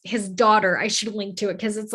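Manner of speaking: usual speaking voice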